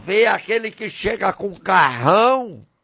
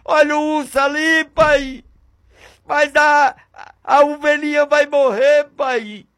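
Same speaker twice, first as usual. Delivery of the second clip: high, urgent pleas to a father; acted fear, pitched high in quick bursts; frightened son; 8 semitones higher than usual. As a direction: plead like a scared boy calling for dad. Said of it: "Ele vai mesmo com medo, dizendo".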